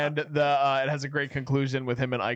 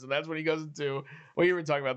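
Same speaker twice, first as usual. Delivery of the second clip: ordinary delivery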